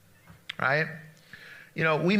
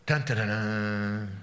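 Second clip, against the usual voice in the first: low, slow mock suspense sting; dramatic dun dun dun; 7 semitones lower, 4 dB quieter, slower than usual. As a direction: hum it like an ominous movie cue. Dramatic sting after stolen blessing.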